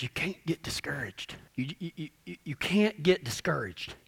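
near-whisper, low and confiding; hushed warning. Real, not acted. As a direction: drop to a whisper to press the point gently. Hushed key warning to audience.

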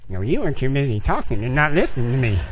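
low, grumbled mock repetition; mocking parroting. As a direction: parrot it back sulkily in a low voice. Mockingly parrots instructor's reply.

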